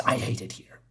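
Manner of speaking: hushed, breathless whisper; a low unvoiced villain whisper. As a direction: whisper it like a secret grudge. Reading villain's line from comic.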